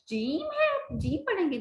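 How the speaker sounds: a pupil reading जीम है high and wrong; mock student misreading. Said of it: "नहीं भाई".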